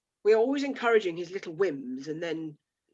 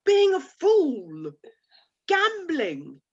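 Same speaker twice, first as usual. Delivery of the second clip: scornful; 7 semitones higher, 6 dB louder, much slower than usual. Acted. Scornful marital argument.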